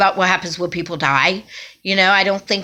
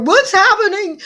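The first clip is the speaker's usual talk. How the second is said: high, crying wail of panic; 4 dB louder than usual. Retold or imitated. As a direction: sob the question out. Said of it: "I start wailing".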